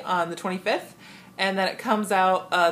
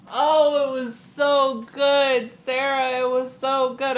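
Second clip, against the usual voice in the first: high, slow emphatic rave; real gushing; 5 semitones higher, 3 dB louder, slower than usual. Real, not acted. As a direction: gush with drawn-out words. Gushing about Hamilton to friend.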